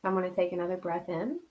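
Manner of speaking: soft, hushed meditation voice; a quiet spiritual breathing guide; guided meditation. Acted. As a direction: guide them into calm, breath by breath.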